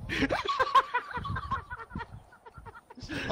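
shrieking high laughter. Real, not acted. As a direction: cackle way up high. Laughing at own story.

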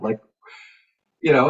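usual speaking voice

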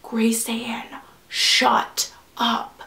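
soft, urgent stage hush; hushed scolding. Retold or imitated. Friends hushing her mid-scene, soft scolding. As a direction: quietly tell someone to stop. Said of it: "they were like".

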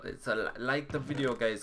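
ordinary talk, nothing marked

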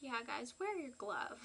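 ordinary talk, nothing marked

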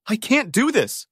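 plain reading voice

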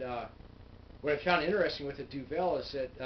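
speaking with hand at mouth; fingers resting on lips. Real, not acted. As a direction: lips partly touched by fingers. Seen 1: two fingers touch lower lip throughout labelled words.

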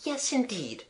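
soft, high, warm ladylike answer; warm reply. A narrator's reading. Wreath-hatted lady answering the respectable Ugly-Wuggly, warmly. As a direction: agree warmly, lightly and softly. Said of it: "answering warmly".

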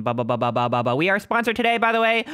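normal talking voice

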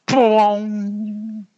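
high, long ringing plong; drawn-out high twang; twanging sound word. A narrator's reading. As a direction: let it ring like a spring. Sound of tree springing back.

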